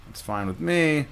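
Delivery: ordinary talk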